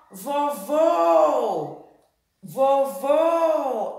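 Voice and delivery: "vovô" called loud and high with long closed "vô"; "vovô" belted with a held final ô; stress on the last syllable. Shown as a demonstration. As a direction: stretch the second syllable of "vovô". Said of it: "a sílaba que mais se alongou".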